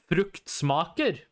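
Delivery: "fruktsmaker" slowly, every syllable clear; "fruktsmaker" yavaşça, her hece tek tek; slow Norwegian reading. Shown as a demonstration. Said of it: "the way it's written".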